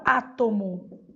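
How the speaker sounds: "átomo" with the stress on Á; stress on first syllable. Shown as a demonstration. Teacher giving a proparoxytone example, "átomo" stressed on Á. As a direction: lean on the opening Á of "átomo". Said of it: "átomo acentuada".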